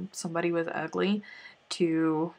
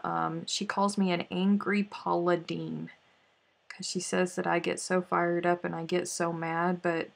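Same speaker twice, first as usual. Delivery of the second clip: usual speaking voice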